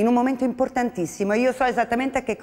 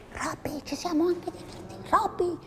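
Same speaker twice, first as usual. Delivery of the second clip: soft coaxing; 6 semitones higher, 8 dB quieter than usual. Acted. Teacher whispers to Robby.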